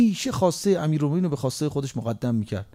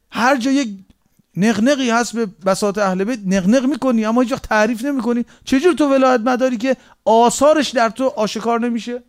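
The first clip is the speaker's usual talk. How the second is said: fiery preaching; 4 semitones higher, 3 dB louder than usual. Acted. Rebukes the unpracticing devotee.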